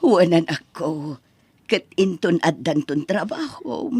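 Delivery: low, hushed and tearful; a grateful mother whispers to her son; soft whisper. Acted. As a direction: whisper it with heartfelt thanks.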